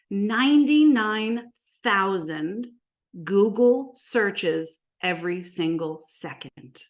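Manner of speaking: slow, spaced-out big statistic; drawn out for impact; slow emphasis. Real, not acted. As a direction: let each word of the figure land separately. Big figure stressed, then restated.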